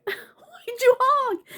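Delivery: slow, high, drawn-out accusing whine; mock angry demand. Fallen woman glaring at the honker, mock-aggrieved whine. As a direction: drag out the retold accusation, pitched high. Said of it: "I'm just glaring at this fucking guy".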